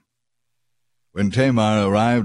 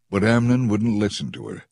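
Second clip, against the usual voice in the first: plain reading voice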